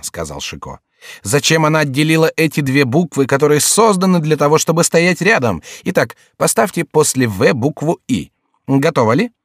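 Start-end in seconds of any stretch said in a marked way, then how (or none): 1.2-9.3 higher-pitched, theatrical court fool; jester holding forth. A narrator's reading. Jester lamenting split letters and dictating, theatrical and higher. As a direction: declaim a mock lament, then give orders.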